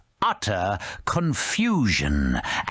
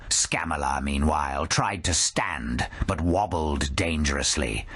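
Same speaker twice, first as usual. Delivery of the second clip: ordinary delivery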